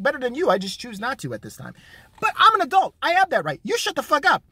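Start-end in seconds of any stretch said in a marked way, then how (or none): 2.2-4.4 ranting. Real, not acted. Ranting at Hogg.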